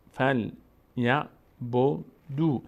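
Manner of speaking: "فل، یا، بو، دو" separately, "دو" held as oo; long "oo", syllable by syllable. Shown as a demonstration. Teacher sounding out a verse for learners, separated syllables. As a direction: spell out "فل، یا، بو، دو" in detached syllables.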